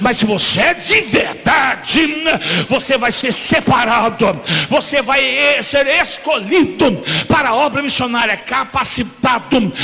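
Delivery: loud, rhythmic sermon shout; full-force preaching. Acted. Pastor preaching loudly on being a true man of God. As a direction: preach it hard to the congregation.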